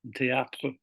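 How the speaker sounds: "centre, théâtre" in a French accent; French pronunciation. Shown as a demonstration. Teacher tracing the spelling to French, French accent. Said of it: "it comes from the French".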